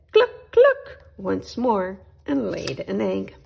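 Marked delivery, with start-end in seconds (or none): 0.1-1.0 very high, loud squawking cluck; hen clucking. Acted. Hen clucking as she lays an egg. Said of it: "Then she said".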